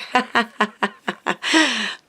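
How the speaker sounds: quiet helpless laugh; soft real laugh. Real, not acted. Laughing at a friend's funny surprise gift, soft real laugh. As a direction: laugh to yourself at the joke.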